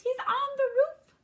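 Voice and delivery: very high, loud panicked piglet; terrified little pig cry; shivering fear. A narrator's reading. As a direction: squeal the alarm. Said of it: "shivered Horace".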